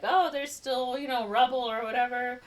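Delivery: high, airy bluffing reply; mock-casual bluff. Pretend wife bluffing about the subway, mock-casual.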